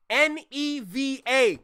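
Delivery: slow, deliberate letter-by-letter spelling; each letter drawn out and separate. Shown as a demonstration. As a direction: spell it out slowly like a fussy teacher. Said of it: "type like a Caribbean, do it right".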